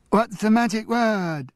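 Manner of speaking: high, teasing grandfather voice; playful game voice. Acted. Grandfather teasing the twins, high playful voice.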